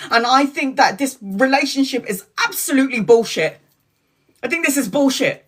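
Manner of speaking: venting years of anger at a friend on the phone; angry telling-off. Retold or imitated. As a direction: let it all out, furious and fast.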